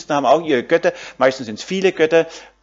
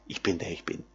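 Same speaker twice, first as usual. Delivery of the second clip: hushed reverence; 8 semitones lower, 14 dB quieter than usual. Acted. Preacher to his listeners, repeating God's name hushed and low. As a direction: lower and much softer, almost to himself.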